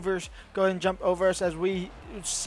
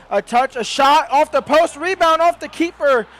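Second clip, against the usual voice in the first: higher, louder rush of excitement; excited commentary; 6 semitones higher, 6 dB louder than usual. Real, not acted. Commentator erupts as the shot hits the post, excited call.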